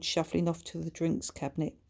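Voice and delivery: usual speaking voice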